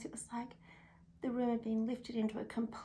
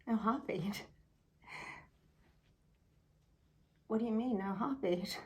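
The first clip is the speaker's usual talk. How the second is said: stunned disbelief. Retold or imitated. Stunned mother questions the news.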